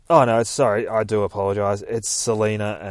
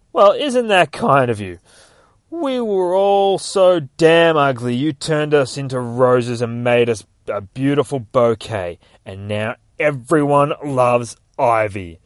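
loud, raised-pitch sarcastic gushing; mocking; 4 semitones higher, 9 dB louder than usual. A narrator's reading. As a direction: taunt with big fake admiration. Batman mocking Ivy to her face.

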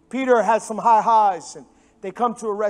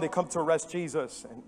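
usual speaking voice

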